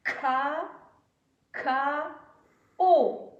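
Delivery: K, K, O as kaa, kaa, oh with long gaps; syllable by syllable. Shown as a demonstration. Teacher lays out the word for pupils, kaa, kaa, oh one by one. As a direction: separate each syllable, landing loud and high on O. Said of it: "Donc nous avons".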